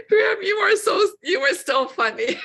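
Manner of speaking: high-pitched laughing reply. Real, not acted. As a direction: laugh through the words. Laughing at his heaven joke.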